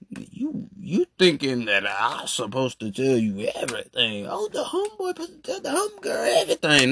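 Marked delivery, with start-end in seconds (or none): 4.3-6.9 very high, fast mock whine; mocking a girl's demand. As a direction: sneer it up high. Mocking her expectation.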